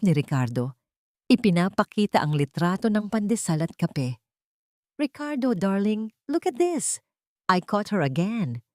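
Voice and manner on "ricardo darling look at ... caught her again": honeyed, falsely sweet voice; sweet poison. A narrator's reading. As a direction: coo it with venom underneath. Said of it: "puno ng malambing na lason".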